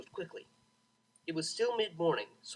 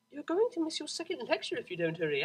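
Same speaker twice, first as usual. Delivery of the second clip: much higher, prim reminder; fussy friend; 11 semitones higher, faster than usual. A narrator's reading. Octavia's text nagging about the lecture, high and prim. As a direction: nag her like a prim friend.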